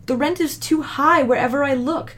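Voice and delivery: high, begging tenant voice; pleading. Acted. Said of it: "Bob pleaded".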